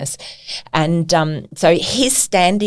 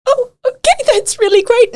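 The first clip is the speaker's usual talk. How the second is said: awkward gush; 3 dB louder than usual. Retold or imitated. Retold awkward reply to star.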